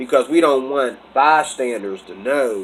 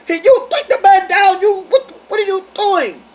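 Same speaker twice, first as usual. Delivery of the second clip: high, loud frantic shriek of the woman; hammed-up shrill lady yelling at the attacker; shrieking African lady; 4 dB louder than usual. Bystander lady yells at the man being tackled, frantic high shriek. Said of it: "She was hitting him with a purse".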